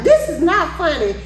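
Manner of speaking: high-pitched mock scolding; upset officer. Upset officer to the laughing driver, high-pitched scolding. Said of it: "he was upset".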